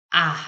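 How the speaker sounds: आह with the mouth wide open, as in "apple"; isolated open आह for short A; short A, big mouth. Shown as a demonstration. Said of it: "आ में आपका big mouth".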